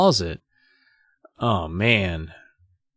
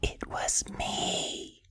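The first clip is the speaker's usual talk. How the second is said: unvoiced, slow secret whisper; slow eerie whisper into her ear; 3 semitones lower, slower than usual. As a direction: lean in and breathe the confession. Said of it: "whispers into her ear".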